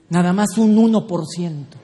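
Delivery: usual speaking voice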